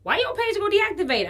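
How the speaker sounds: high suspicious question. Retold or imitated. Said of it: "I'm like".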